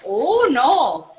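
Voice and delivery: a light Michigan accent put on for a laugh. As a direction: Michigan vowels, played a bit wine-loose. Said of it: "that's when my Michigan accent comes out".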